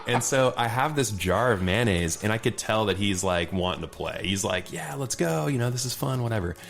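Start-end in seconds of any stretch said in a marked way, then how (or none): 4.7-6.6 higher-pitched, game-for-it quote; Elijah Wood, eager. Retold or imitated. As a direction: imitate someone keen to join in. Voicing Elijah Wood's eagerness to play.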